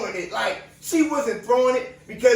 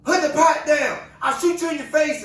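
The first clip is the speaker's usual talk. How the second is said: retold screaming cop; 6 semitones higher than usual. Cops yelling threats at the woman, imitated shout. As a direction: shout it like a panicking officer, quickly. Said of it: "They screaming".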